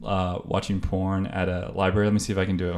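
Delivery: usual speaking voice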